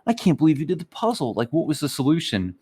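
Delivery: put-on high, fast, gushing girl voice; gushing student. Imitating an impressed student NPC, high and gushing. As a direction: play her wide-eyed and impressed. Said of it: "the girl's like".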